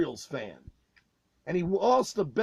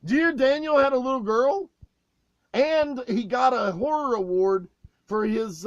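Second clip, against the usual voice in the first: usual speaking voice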